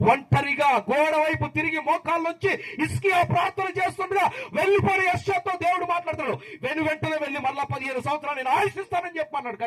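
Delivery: high-pitched, sustained sermon cry; fervent preacher at full stretch; impassioned preaching. Real, not acted. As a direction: drive each phrase home on a high pitch.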